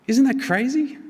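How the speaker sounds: rises high in amazement; amazed. Real, not acted. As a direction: let the wonder burst out. Preacher marvels at Jesus serving.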